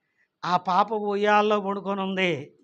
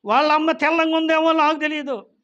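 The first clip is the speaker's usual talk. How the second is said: higher, louder fervent preaching; preaching at full force; 8 semitones higher, 7 dB louder than usual. Real, not acted. Preacher cries out to congregation, loud and fervent. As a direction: drive the point home to the church.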